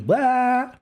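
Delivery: high-pitched silly blah noise; mock "blah" cry. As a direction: blurt a comic high blah. Imitating silly face on annihilator.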